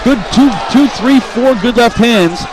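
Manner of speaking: rising fast, shouting the punch count; excited play-by-play. Real, not acted. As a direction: call the flurry, fired up. Live knockout flurry call.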